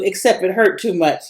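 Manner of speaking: ordinary delivery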